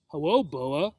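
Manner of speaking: mildly polite, small greeting rising at the end; a touch of timid courtesy. A narrator's reading. Obi greets the snake back, politely. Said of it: "said Obi politely".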